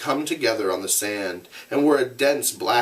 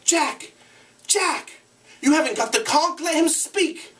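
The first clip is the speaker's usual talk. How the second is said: much higher urgent calls; calling out. Acted. Ralph calling Jack to order, high and urgent. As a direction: cut in sharply to stop him.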